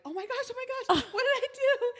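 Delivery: very high mock-panicked squeal; freaking out. Driver retelling being ordered over, high laughing panic. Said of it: "I was freaking out".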